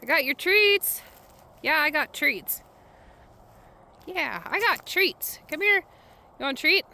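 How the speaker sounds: high coaxing dog-talk voice. Real, not acted. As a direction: coax the dog with treats.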